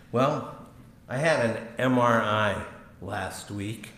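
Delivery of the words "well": normal talking voice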